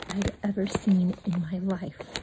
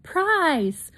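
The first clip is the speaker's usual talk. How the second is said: little brother yell; 8 semitones higher than usual. A narrator's reading. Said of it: "my brother yelled".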